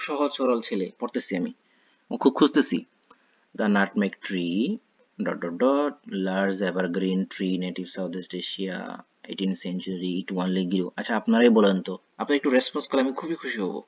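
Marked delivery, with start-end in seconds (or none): 3.6-10.9 very slow, word-by-word plodding read; slow naive reading. Shown as a demonstration. Teacher shows students how a naive reader plods slowly. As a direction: read it like a simple student inching along. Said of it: "এখন আমি যদি পড়ি".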